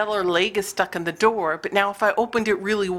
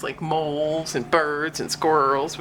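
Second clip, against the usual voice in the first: ordinary talk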